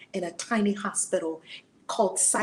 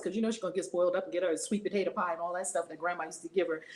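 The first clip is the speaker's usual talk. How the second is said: normal talking voice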